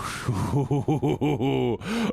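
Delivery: rising hooting laugh; breathy laugh. Real, not acted. Reacting to Crow's message.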